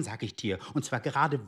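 usual speaking voice

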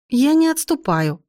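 a mild defensive protest; defensive, justifying. A narrator's reading. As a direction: plead the excuse, drawing out the first words. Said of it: "попыталась оправдаться я".

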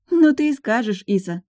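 mock disbelief; scoffing. A narrator's reading. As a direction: scoff at her friend. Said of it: "хмыкнула Линара".

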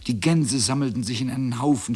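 plain reading voice

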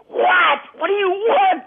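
high, loud yelled what do you want; angry shout. Retold or imitated. Man snapping up a ringing phone, shouting. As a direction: bark it down the phone in fury.

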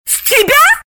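very high, appalled exclamation; aghast, drawn-out high protest; horrified. A narrator's reading. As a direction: recoil at the very idea. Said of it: "ужаснулась Галина".

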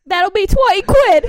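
loud, high British hawker-like demand; Carol. Pretend. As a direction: demand payment as a brash British harridan. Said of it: "she's yelling at the children".

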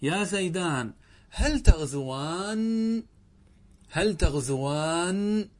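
each syllable of "هل تغزوان" drawn out; teacher modelling the dual form slowly; very slow model sentence. Shown as a demonstration. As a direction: spell it out slowly, stress on هل. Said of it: "ماذا تقول".

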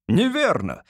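high, triumphant exclamation. A narrator's reading. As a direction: pounce on it gleefully. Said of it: "торжествующе воскликнул председатель суда".

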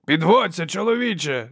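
high, mocking call; jeering shout. A narrator's reading. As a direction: heckle the fallen man gleefully. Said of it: "зареготав брат".

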